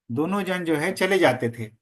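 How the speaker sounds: ordinary delivery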